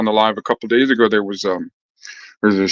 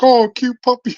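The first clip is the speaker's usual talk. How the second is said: mock sweet; 11 semitones higher than usual. Friend voiced in retold story.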